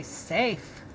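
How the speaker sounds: safe with a long A, said slowly; teacher sounding out a word. Shown as a demonstration. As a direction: stretch the A in safe. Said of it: "the E tells the A to say A".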